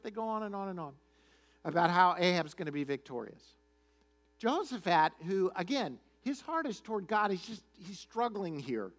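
usual speaking voice

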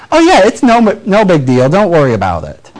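high, bright brush-off that sinks back down; mock Midwestern "no big deal"; breezy dismissal. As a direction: wave the apology away cheerily. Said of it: "So we'll say".

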